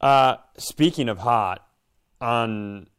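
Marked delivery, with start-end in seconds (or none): none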